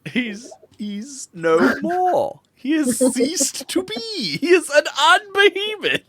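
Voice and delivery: very high, slow comic protest; mock indignant rant. Parodying the dead parrot rant, mock indignant.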